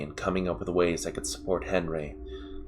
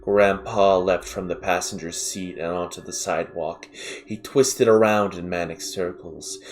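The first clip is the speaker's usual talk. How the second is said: ordinary delivery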